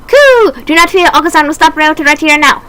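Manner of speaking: a small dove charging in; brave pigeon character. Acted. Pigeon Oka-san leaping to stop Ryota with a high cry.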